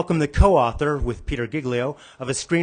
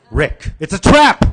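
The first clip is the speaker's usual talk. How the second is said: loud, higher alarmed cry; warning shout; 6 semitones higher, 10 dB louder than usual. Acted. Character warning Rick of the trap, loud shout. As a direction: yell the warning across the room.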